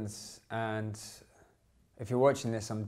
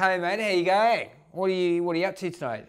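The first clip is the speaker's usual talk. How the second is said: lightly voiced hearty bloke, louder; loud, higher matey greeting; friendly Aussie mate; 4 semitones higher, 5 dB louder than usual. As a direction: greet a mate at a bar, pitched up. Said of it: "this guy turned up to me and goes".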